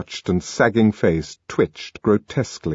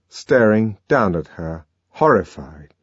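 usual speaking voice

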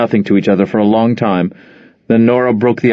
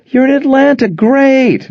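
much higher, bright surprised mother; delighted phone greeting; 3 dB louder than usual. Acted. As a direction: greet your daughter warmly on the phone. Mother on phone, forced cheer.

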